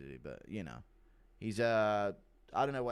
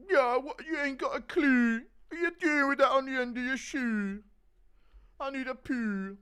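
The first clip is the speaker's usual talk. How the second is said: [name] impression; 13 semitones higher, 6 dB louder than usual. Walking in on Connor, loud high London rapper challenge. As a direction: confront him like a London rapper barging in. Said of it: "Impression of [name] walking in on Connor".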